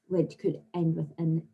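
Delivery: ordinary delivery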